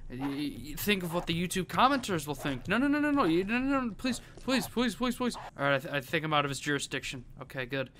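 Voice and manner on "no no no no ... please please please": frantic pleading. Real, not acted. Pleading with the dog.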